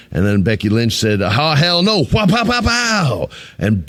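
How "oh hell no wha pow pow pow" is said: higher, slow hammed-up fight cry with pow sounds; mock attack cry. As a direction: ham up a ninja punching, pow pow pow. Voicing Becky's ninja attack.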